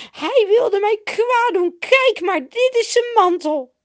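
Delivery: high, loud weepy wail; hammed-up crying accusation; tearful whining. A narrator's reading. Potifar's wife shows them his cloak, wailing tearfully. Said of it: "heel een huilerige stem".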